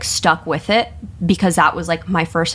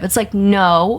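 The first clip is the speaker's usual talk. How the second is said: ordinary talk, nothing marked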